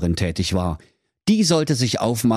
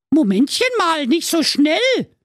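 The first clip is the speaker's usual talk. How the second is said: calling out; 4 dB louder than usual. Acted. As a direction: hold him off in a cartoon squeal. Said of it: "rief da die Trulla".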